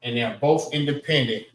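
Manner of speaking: normal talking voice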